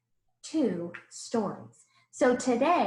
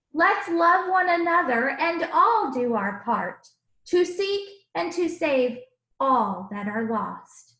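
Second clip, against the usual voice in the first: put-on lively rhyming read-aloud for children; storybook reading; 2 semitones higher, 3 dB louder than usual. A narrator's reading. Teacher reading a picture book to the class, animated. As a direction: read the verses with bounce and warmth.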